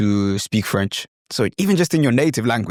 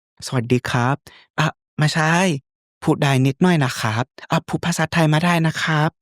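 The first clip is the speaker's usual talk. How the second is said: put-on higher, polite Thai-accented voice; Thai character; 5 semitones higher, much faster than usual. Replying to people asking if he's Thai, Thai accent. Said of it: "becoming this Thai character".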